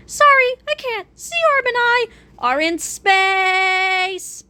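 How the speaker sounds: high, drawn-out cartoon voice; high-pitched faraway agent voice. A narrator's reading. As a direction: call it in high, stretching the last word. Agent calls in from space.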